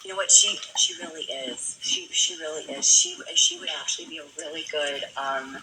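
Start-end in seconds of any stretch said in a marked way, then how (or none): 0.3-1.8 whispered. Real, not acted. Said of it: "repeats line, first whispered".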